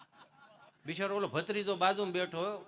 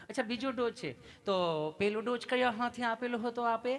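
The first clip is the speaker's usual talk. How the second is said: higher, honeyed nurse question; sweet nurse voice; 5 semitones higher than usual. Retold or imitated. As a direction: play the syrupy nurse. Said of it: "નર્સબેને એટલું પ્રેમથી પૂછ્યું".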